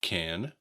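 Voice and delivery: "Can" ending on a drawn N, pitch rising; no final T. Shown as a demonstration. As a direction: let "Can" end on N, without any T. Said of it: "difficulty with the T at the end".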